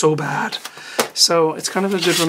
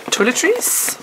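toiletries sung out much higher with a rising lilt and an odd vowel; playful high toiletries; 10 semitones higher, slower than usual. Acted. Vlogger jokingly repeating toiletries, pitched high. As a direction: toss toiletries up high like a joke.

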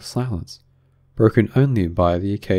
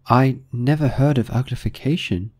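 higher, tentative Alice; venturing Alice; 5 semitones higher than usual. A narrator's reading. Alice venturing a question on uglification, high and tentative. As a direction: venture it in a lighter, raised voice. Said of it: "Alice ventured to say".

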